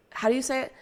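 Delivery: usual speaking voice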